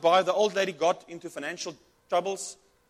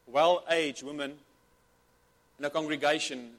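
careful rewording; 3 semitones lower, 3 dB louder than usual. Real, not acted. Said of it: "Sorry, I mean".

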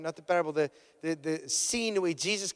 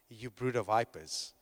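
ordinary talk, nothing marked

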